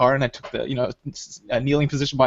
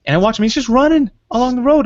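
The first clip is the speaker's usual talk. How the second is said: excited retelling; 12 semitones higher, 5 dB louder than usual. Real, not acted.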